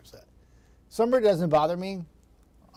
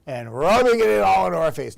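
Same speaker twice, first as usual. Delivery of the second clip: louder, higher mock indignation; mock complaint; 3 semitones higher, 8 dB louder than usual. Real, not acted. Weatherman mock-grumbling about a colleague on holiday, louder. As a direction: play up being wronged, jokingly. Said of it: "No, she wasn't rubbing it in".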